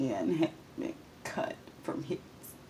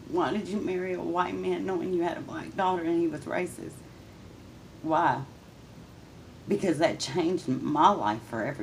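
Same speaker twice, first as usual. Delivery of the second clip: anguished confrontation; 6 semitones higher than usual. Real, not acted. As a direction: plead and accuse at once. Confronting mother directly.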